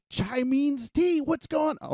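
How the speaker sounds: squeaky high and loud, slowed on "Chai means tea"; silly high shriek getting carried away; goofy over-the-top voice. Acted. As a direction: ham it up in a cartoon squeal. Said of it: "I'm gonna calm it down for a second here".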